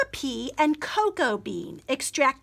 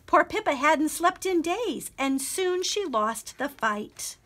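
plain reading voice

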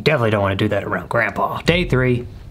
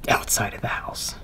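whispering; 8 dB quieter than usual. Real, not acted. Hiding from grandma, whispering.

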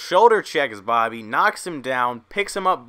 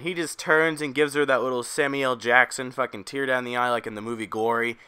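ordinary talk

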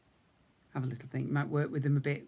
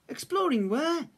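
much higher questioning cub voice; eager to know; little cub asking Big Bear; curious cub; 13 semitones higher, 3 dB louder than usual. A narrator's reading. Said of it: "Little Bear asked".

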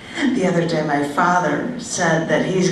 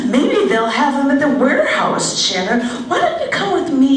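higher, lightly mocking bride voice; imitating the bride friend; 7 semitones higher than usual. Mimicking the bride friend asking for help, higher pitched. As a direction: play her bright pleading tone, higher.

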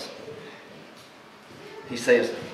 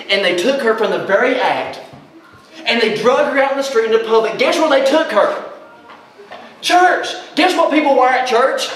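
much higher, louder preaching peak; fervent preacher at full cry; preaching at full force; 11 semitones higher, 5 dB louder than usual. Real, not acted. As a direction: hammer it to the crowd. Preacher climaxing story.

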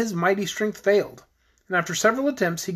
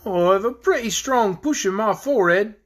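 dragon character; 3 semitones higher than usual. A narrator's reading. Dragon offering to force the door, confident character voice.

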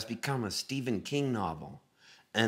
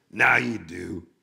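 loud, put-on singer's voice; 3 semitones lower, 6 dB louder, slower than usual. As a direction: state it loudly as the imitated star. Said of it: "And he goes".

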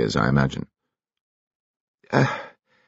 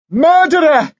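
shrieked, very high and much louder; screaming accusation; 7 dB louder, slower than usual. Acted. Prisoner screams at his handcuffed boss, frantic shriek. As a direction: scream it at the man who betrayed you. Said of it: "screamed Dimitri".

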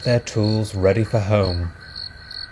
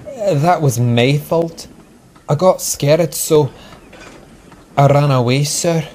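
halting, sheepish confession in a higher voice; 5 semitones higher than usual. A narrator's reading. Engine owns up to the policeman, sheepishly. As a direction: confess with awkward pauses. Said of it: "said Douglas sheepishly".

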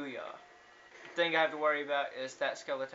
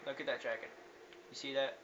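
ordinary talk

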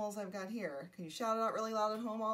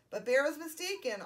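ordinary delivery, nothing marked

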